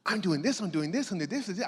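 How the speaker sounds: mock-eager rush of excitement; her high energy. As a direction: bounce through it like she did. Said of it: "Blew me away with her energy".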